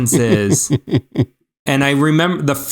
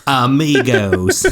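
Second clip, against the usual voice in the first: drawn-out gringo "amigos"; exaggerated American-accented Spanish; slower than usual. Shown as a demonstration. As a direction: drag the vowels in a thick American accent. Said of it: "my best gringo accent".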